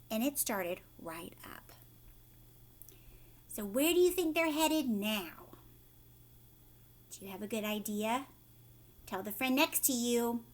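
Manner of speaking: ordinary delivery, nothing marked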